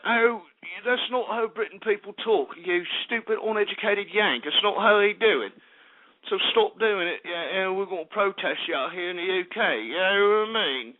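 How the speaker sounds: offended Brit, put-on British accent; hammed-up British accent, scolding a Yank; mock British accent. Host imitating offended UK listeners, mock British accent. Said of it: "whenever I do the accent".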